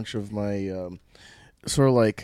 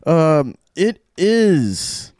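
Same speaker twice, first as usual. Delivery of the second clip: radio ad read; 6 semitones higher, much slower than usual. Acted. Promo for boat tour.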